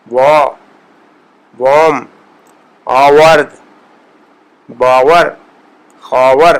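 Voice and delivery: وا وام آورد باور خاور with the واو like English w; Persian w sound. Shown as a demonstration. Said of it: "اس کے واو کی طرح ہے".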